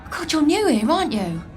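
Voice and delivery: ordinary delivery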